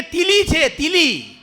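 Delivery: high loud lisped shout of તીલી; loud lisping cry. Retold or imitated. Lisping groom says lizard.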